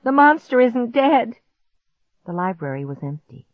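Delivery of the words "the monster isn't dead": high, loud alarm; panicked raised voice; crying out. A narrator's reading. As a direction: cry out a dreadful warning. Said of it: "I cried".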